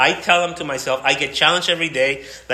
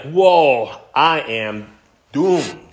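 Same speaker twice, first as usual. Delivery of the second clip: mock awe; 6 dB louder, much slower than usual. Voicing a person undone by glory.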